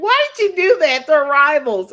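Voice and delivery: high, loud indignant why; mock-scold a friend for switching rivals; mock outrage. Mother teasing her friend, high and loud outrage. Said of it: "I was like".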